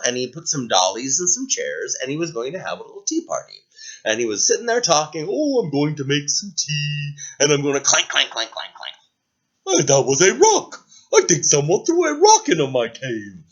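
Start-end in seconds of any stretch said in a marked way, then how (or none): 5.3-13.4 cheerful lightly voiced dragon; a gentle dragon puttering about; kindly dragon hosting tea; friendly dragon. Dragon character voice.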